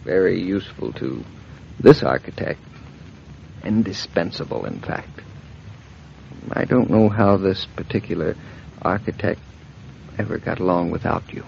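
soft, intimate and loving; tender murmur. Acted. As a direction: murmur it close to her ear. Husband softly flirting with wife.

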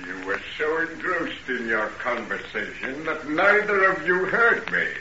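normal talking voice

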